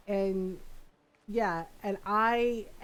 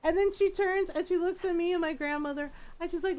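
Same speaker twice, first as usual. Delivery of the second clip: ordinary talk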